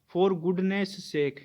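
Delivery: drawn-out example, word by word; slow dictation. Shown as a demonstration. Teacher reading an example to students, very slowly. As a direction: spell the phrase out slowly for the class.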